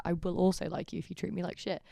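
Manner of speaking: normal talking voice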